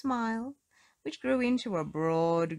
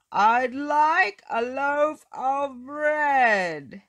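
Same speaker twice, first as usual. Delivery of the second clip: slow, loud booming voice; big voice demanding bread; 8 semitones higher, 10 dB louder, much slower than usual. Acted. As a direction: boom the order out slowly. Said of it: "he boomed".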